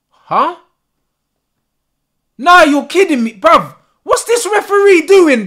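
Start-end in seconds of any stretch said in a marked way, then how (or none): 2.4-5.5 loud, high-pitched indignation; outraged disbelief. Real, not acted. Fan stunned by the referee's call, outraged shout.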